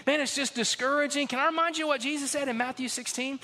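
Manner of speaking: full-force sermon delivery; preaching. Acted. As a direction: preach it out, rising and fervent.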